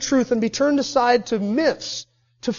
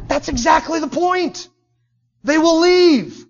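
higher, forceful comeback; emphatic retort; 6 semitones higher, 4 dB louder, slower than usual. Acted. Paul answering Timothy's objection, high emphatic retort. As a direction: drive the point home, pitched up and slowing.

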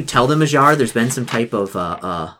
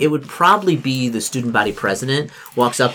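normal talking voice

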